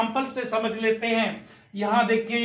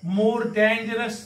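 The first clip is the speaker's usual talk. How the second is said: slow model answer; much slower than usual. Shown as a demonstration. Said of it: "इसके पहले क्या लिखेंगे?".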